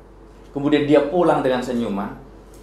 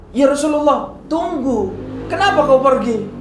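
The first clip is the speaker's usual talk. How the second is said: calling after him; 10 semitones higher than usual. Retold or imitated. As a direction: call out to stop him leaving. Abu Bakar calls after the Prophet.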